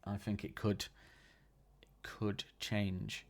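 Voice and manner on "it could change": slow, secretive whisper. Pretend. Host teasing listeners about the rankings, slow whisper. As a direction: breathe it like a secret, slowly.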